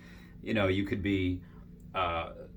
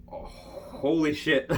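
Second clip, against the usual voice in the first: drawn-out, higher-pitched exclamation; amazed; 6 semitones higher, much slower than usual. Real, not acted. Cosplayer recalling how hard military and cosplayers party, amazed. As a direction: stretch it out in amazement, about to laugh.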